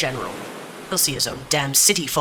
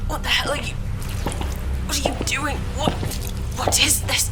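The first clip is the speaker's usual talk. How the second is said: anguished outrage; 10 semitones higher than usual. Acted. Betrayed general crying at his killers, anguished outrage. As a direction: cry out as the knife goes in.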